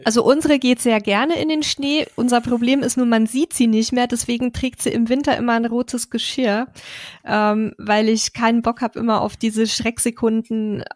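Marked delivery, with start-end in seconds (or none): none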